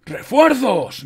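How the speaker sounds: high, drawn-out loud cry; long rallying yell; shouting. A narrator's reading. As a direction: shout the news across the battlefield. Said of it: "gritó".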